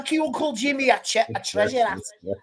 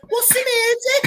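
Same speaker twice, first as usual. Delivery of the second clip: Scouse criminal; 13 semitones higher, 4 dB louder than usual. Retold or imitated. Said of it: "I can still remember".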